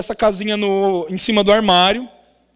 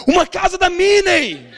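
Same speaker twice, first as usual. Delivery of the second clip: high, drawn-out eager exclamation; excited child; 7 semitones higher than usual. Retold or imitated. Dad mimics daughter's Christmas wish, excited. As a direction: imitate a thrilled little girl, pitched up.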